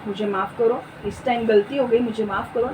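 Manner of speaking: soft, low, conciliatory reply; gentle apology. Acted. Answering someone who has flared up, softly apologetic. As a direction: demonstrate a meek plea to be forgiven. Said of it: "कैसे उत्तर देना है?".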